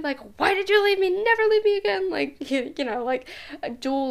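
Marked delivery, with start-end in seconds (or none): 0.4-2.1 put-on much higher and louder, whiny little-kid plea; clingy child. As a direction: play the abandoned child scolding the mother. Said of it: "me being, like, a mom and a child".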